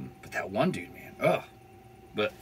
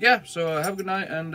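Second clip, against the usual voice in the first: much higher, friendly officer; the cop signing off kindly; cop goodbye; 10 semitones higher, 4 dB louder than usual. Retold or imitated. Cop wishing him good night, voiced higher.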